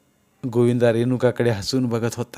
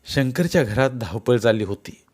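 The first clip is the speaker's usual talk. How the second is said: plain reading voice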